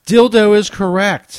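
big game-show reveal, drawn out; excited announcement. Real, not acted. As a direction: announce the win with a grin. Host announces correct answer.

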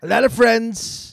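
high slow Trump voice; Trump impression. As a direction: Trump bragging. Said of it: "Trump impression continues".

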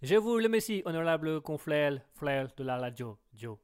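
put-on caricatured Chinese accent, last syllables echoed; petite Chinoise. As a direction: play a little Chinese woman with a verbal tic. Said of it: "On va faire la petite chinoise". (accent chinois caricatural avec tic verbal)

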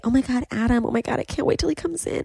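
high, fast mock gush over a crush. Friend mimics Zara gushing about Adam, high giddy gush. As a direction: play the giddy fangirl.

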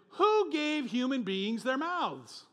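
God challenging Moses. Acted. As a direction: thunder the question from above.

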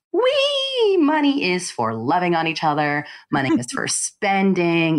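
high drawn-out squeal, then breezy glee; giddy spender. Retold or imitated. Podcast guest mocking their younger money-happy self, giddy. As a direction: playfully mimic a carefree spender.

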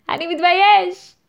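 high, loud mock-triumphant cry; loud proud declaration. Host playfully shouting the shame out with pride. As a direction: proclaim it playfully at full voice. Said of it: "ופשוט להחזיק בה בגאון, להגיד".

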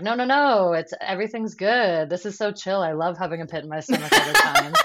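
hammed-up insistence that all is well; delusional self-reassurance. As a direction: talk yourself into believing it's all great. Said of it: "as delusional as possible to talk yourself into being like".

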